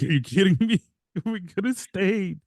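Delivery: high-pitched exasperated disbelief; mock exasperation. Telling the cohost about seeing nine PM on the dashboard, exasperated. Acted reaction to seeing the time.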